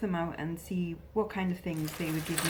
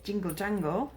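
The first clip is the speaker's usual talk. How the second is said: normal talking voice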